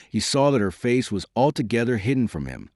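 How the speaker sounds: ordinary delivery, nothing marked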